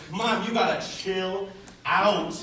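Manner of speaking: exasperated reply. Retold or imitated. Teen driver to protective mom, mild exasperation. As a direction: drag it out at an overprotective parent.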